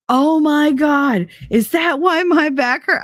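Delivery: high, drawn-out 'oh my God' of dawning panic; hammed-up worried realization; mock alarm. Acting ex alarmed by doll.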